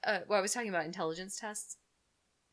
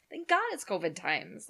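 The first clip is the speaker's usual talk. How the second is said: ordinary delivery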